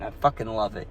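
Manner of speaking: ordinary talk, nothing marked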